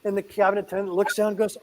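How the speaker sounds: normal talking voice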